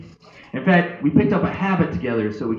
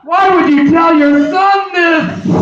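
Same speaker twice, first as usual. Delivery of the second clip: high, slow, loud outburst; acted horrified shout at dad; appalled yell; 13 semitones higher, 10 dB louder, slower than usual. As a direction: yell it in horror, stretching the words. Appalled son reacting to dad.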